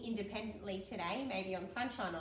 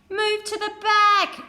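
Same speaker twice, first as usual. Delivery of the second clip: much higher, louder, slow call to the back; bossy driver calling out; driver cries out; 13 semitones higher, 19 dB louder, slower than usual. Acted. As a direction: shout the order down the bus. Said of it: "she cried".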